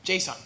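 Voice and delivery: "json" said JAY-sawn, English style; dumb-sounding English "json". Shown as a demonstration. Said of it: "It just sounds dumb".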